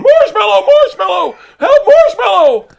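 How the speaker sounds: high, loud panicked scream for help; desperate high yell. Retold or imitated. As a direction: shriek the bear's treat word in terror. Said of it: "I'm just going".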